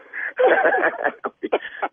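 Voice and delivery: airless wheezing laugh; breathy laugh. Real, not acted. Laughing at the prank.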